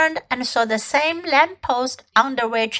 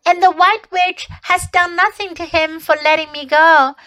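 put-on higher, louder eager child's telling; excited little girl; 7 semitones higher, 4 dB louder than usual. A narrator's reading. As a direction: spill the whole tale breathlessly. Lucy voiced, excited girl.

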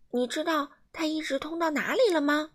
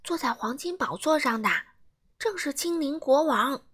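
plain reading voice

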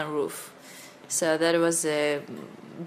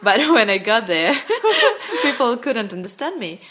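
laughing; 5 semitones higher, 8 dB louder than usual. Real, not acted. Laughing at her own English.

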